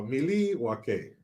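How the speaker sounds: slow, drawn-out movie quote; lightly mimicked rock-star pronunciation. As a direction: stretch it out like quoting a film. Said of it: "that's what it was from Wayne's World".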